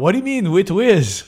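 put-on light Greek accent, puzzled; a hint of Greek accent, confused. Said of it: "in his Greek accent".

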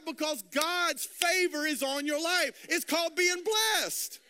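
high, driving sermon delivery; full-force preaching. Acted. Preacher telling congregation of God's favor, full-force preaching. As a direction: preach it up to a ringing finish.

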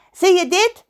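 loud, high pirate demand; hammed-up vain pirate question; Pedro de Wonderpiraat. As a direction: demand attention in a put-on voice. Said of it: "vroeg hij".

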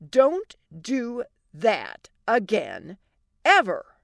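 slow, word-by-word scolding; stern warning. Acted. Wife warning husband after risky fix, slow and stern. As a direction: space out each word, punch the last.

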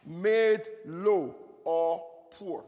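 drawn out meaning; slow and deliberate. Shown as a demonstration. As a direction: spell out the meaning slowly. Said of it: "And Lamech means".

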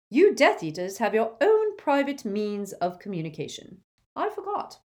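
biting mock, ending high; sarcastic McGonagall. McGonagall taunting Snape with biting sarcasm. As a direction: sneer it at him.

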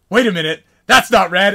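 loud, rising mock suspicion; retold realization. Enemies realise they are tricked.

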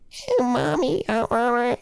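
put-on high squeaky child's voice; kid voice. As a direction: play a little kid yelling for mom. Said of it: "little kids scream".

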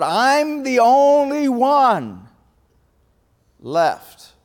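high, loud, slow wail on only; self-pitying Elijah. Retold or imitated. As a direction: drag out only like a martyr. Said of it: "He says".

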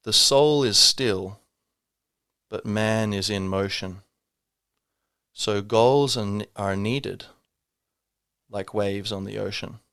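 slow, measured verse with long pauses; poem recital. Shown as a demonstration. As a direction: recite line by line, letting each settle. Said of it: "here's a poem".

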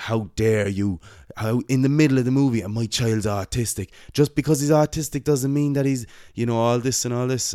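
mother berating the usher, loud, high and fast; angry mother. Retold or imitated. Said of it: "She was like".

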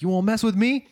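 high, loud movie-quote outburst; mock angry challenge. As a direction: imitate a worked-up man picking a fight. Acted film line, angry man.

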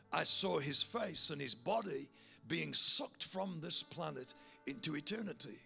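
normal talking voice

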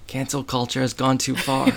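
ordinary talk

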